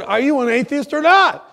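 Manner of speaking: loud, high demand: are you an atheist or not; exasperated demand. Retold or imitated. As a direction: fire the question back at her, fed up. Confronting the patient, exasperated.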